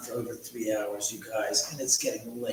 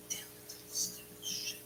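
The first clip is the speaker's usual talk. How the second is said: whispered curse; 15 dB quieter, slower than usual. Real, not acted. Cursing himself about the phone.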